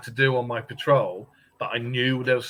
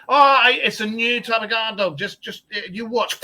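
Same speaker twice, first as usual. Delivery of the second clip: high, loud hammed-up boast; mock proud guard; 9 semitones higher, 6 dB louder than usual. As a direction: show off the cat guard dogs. Mocking himself with cats.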